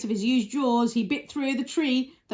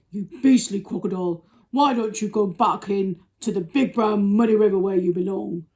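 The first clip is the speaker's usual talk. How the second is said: loud, big scolding elephant; Trunky; 7 dB louder than usual. Acted. Elephant sending the crocodile away, loud and scolding. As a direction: boom out the telling-off. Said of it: "said Trunky in his big".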